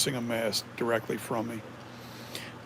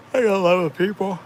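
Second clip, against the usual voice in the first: words stretched by a yawn; 6 semitones higher, 7 dB louder, faster than usual. Real, not acted. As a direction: talk through a big yawn. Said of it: "I'm sorry for yawning".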